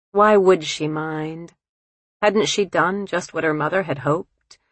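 ordinary delivery, nothing marked

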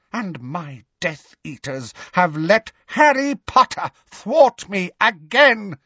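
cold voice rising to a high, loud end; mounting anger. A narrator's reading. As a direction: build to an outraged finish. Voldemort's anger mounts at failure.

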